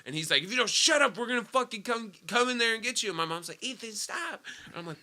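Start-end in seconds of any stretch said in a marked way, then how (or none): 0.4-3.1 high-pitched, mildly angry cop impression; retold cop's threat. 3.6-4.4 high, softened mock yell of a mom; mom's scolding. Retold mom scolding her son.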